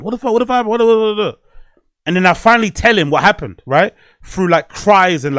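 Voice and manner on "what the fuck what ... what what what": high, sputtering worried-father imitation; mock alarm, tripping over the repeated words; concerned dad. Dad questioning his crying son, imitated concern. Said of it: "He's so concerned".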